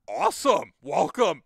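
loud, high-pitched cheer; enthusiastic welcome. Acted. Hobbyist greeting a fan they disagree with, loud enthusiastic welcome. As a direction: burst out in a big, high welcome.

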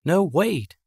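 teacher Mary calling out to the crowd; shouting teacher. Acted. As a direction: call out to stop everyone leaving. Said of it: "Mary shouts".

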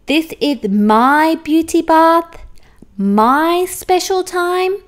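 guard the bath, rising to a yelp; possessive rising protest. Acted. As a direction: unicorn defending her bubble bath, escalating. Unicorn protecting her bath.

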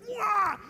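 high-pitched wild holler; gorilla-act yell. Acted. Gorilla performer entertaining the crowd at the bars, high yell. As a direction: let out a showy ape cry. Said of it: "I was like".

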